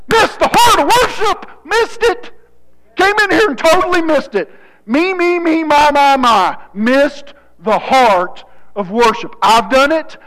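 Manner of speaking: very high, loud pulpit cry; impassioned preaching. Real, not acted. Preacher indicts self-centered worshipers, impassioned preaching. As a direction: cry it out at full force, easing down.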